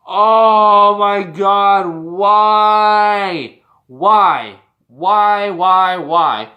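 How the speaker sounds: loud, long drawn-out wail of why; exasperated outcry. Real, not acted. Fan ranting about a booking decision, exasperated outcry. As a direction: cry out in exasperation, stretching it out.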